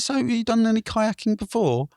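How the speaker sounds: very high cheery officer; officer checking on tourists, high and cheery; patrol officer. Retold or imitated. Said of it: "He said".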